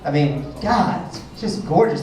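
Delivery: usual speaking voice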